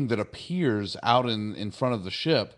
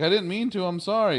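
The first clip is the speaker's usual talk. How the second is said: high-pitched mock-contrite boy; apologetic kid; 9 semitones higher, 3 dB louder, faster than usual. Wesley apologises to the mediators, pleading. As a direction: plead in an imitation child's pitch.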